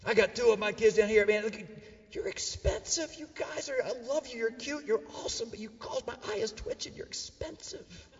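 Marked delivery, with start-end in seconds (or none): 2.1-8.1 high, hushed voice thinning to a whisper; strained parental affection. Acted. Parent to his two expensive kids, strained soft sweetness. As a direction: tell them softly through frayed patience.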